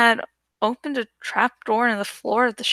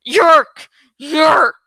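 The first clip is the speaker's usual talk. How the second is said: piercing screeched cries, much louder, rising each time; screaming; 8 dB louder than usual. A narrator's reading. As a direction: shriek it like a mad old woman attacking. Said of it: "and screaming".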